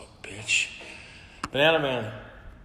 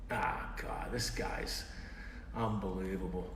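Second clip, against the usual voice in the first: whispered aside; 4 semitones lower, 8 dB quieter than usual. Real, not acted. Muttering in frustration.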